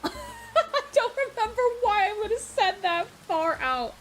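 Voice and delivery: high, loud laughing speech; laughing hard. Real, not acted. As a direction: talk through helpless laughter. Cracks up at own old quote.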